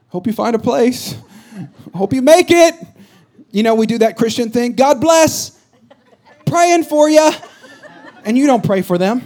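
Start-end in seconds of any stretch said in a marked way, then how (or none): 0.1-2.7 higher-pitched, mock-cheery send-off; breezy well-wishing. Churchgoer to someone without a seat, breezy well-wishing. 4.7-7.3 high, loud, hollow well-wishing; mock-cheery blessing. Churchgoer parting from someone they won't pray for, mock-cheery.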